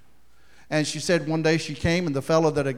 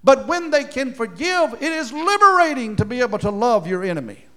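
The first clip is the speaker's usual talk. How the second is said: ordinary talk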